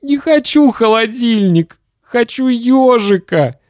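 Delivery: put-on high, wailing child protest; sobbing boy. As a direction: plead through acted tears. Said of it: "всхлипывая, сказал Славик".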